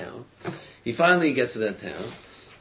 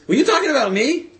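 high, loud, quick retold question; acted-out surprise, pitched up and rushed; startled question; 13 semitones higher, 9 dB louder, faster than usual. Man turns to the saleslady who spoke of him, startled.

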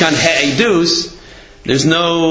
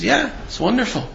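softer, higher, unbothered reply; understated retold approval; calm approval; 8 semitones higher, 6 dB quieter than usual. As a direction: gently act out a rabbi's reassurance. Retold rabbi's calm, contrary answer.

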